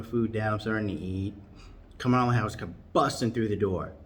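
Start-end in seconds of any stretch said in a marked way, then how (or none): none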